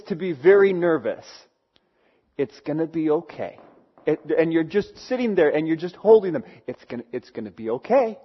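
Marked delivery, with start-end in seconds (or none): 2.4-3.6 softer, quiet reassurance; gentle and hushed; soothing a scared child. Retold or imitated. Parent holding nervous kids. 6.7-8.2 quiet comforting voice; soothing a scared child. Retold or imitated. Parent holding nervous kids.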